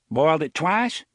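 blustering sheriff voice. Acted. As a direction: play the fussy rural sheriff. Avery voiced.

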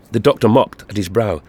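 usual speaking voice

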